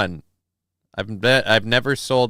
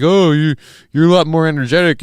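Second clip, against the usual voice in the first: imitating people; 3 semitones higher, 6 dB louder than usual. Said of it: "people are like".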